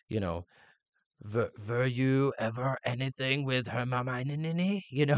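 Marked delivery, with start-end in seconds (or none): 1.2-4.8 put-on slow, silly Bulgarian accent; halting mock Bulgarian, garbling Hermione; Victor Crumb. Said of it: "And he's like".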